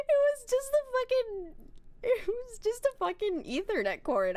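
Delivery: mock crying. Exasperated retelling of mother fight.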